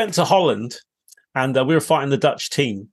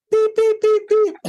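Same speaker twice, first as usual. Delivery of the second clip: high squeaky beeping noise; mock beeping; slower than usual. Teller adding a comic beep noise to the story, high and squeaky. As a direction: squeak out beeps like a toy.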